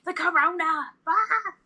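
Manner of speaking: high mock panic cry for the husband; mocking panicked husband. Mocking her husband's virus panic, a high squeal. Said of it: "He's like".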